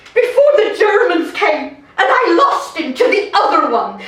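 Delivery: loud, high, scornful outburst; jealous tirade. Acted. Wife on losing her husband to the famous mistress, jealous tirade.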